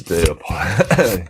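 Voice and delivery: choking mid-word; words caught in the throat while choking. Real, not acted. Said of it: "apologies as I'm choking".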